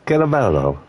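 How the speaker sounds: Caraballo with a rolling Italian accent; hint of an Italian accent on Caraballo. Shown as a demonstration. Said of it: "I wanna say it in, like, an Italian accent".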